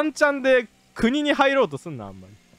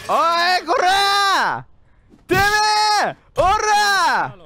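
angry shout; 10 semitones higher, 5 dB louder, much slower than usual. Acted. Border guard yelling at a fleeing traveler, furious shout. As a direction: yell it out, high and loud.

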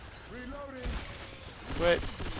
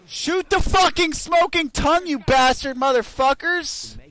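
high, loud furious cursing; angry yell; 7 semitones higher, 7 dB louder than usual. Real, not acted. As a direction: bark at the dumb team. Yelling at useless teammates.

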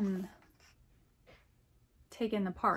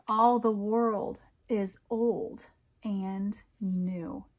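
slow, lilting picture-book reading; poem read aloud; 5 dB louder, much slower than usual. A narrator's reading. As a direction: read it like a bedtime rhyme for kids.

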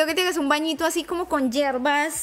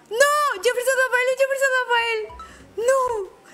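anguished shout; 9 semitones higher than usual. Real, not acted. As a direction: yell the name in real despair. Player loses the roulette spin.

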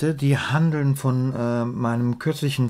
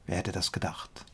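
much softer, low throwaway remark; hushed, low comic aside; muttered aside; 5 semitones lower, 10 dB quieter than usual. Real, not acted. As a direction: drop it almost to a whisper. Dry aside after obvious title.

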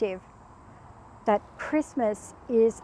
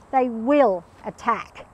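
ordinary delivery

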